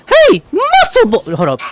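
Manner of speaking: normal talking voice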